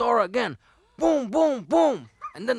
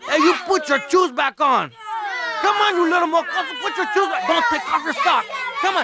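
put-on higher, louder scolding of the kids; angry scolding; 7 semitones higher, 5 dB louder, faster than usual. Scolding kids taking shoes off.

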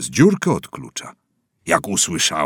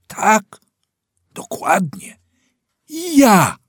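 emphatic confirmation; 14 semitones higher, 9 dB louder, slower than usual. A narrator's reading. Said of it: "emphatic confirmation".

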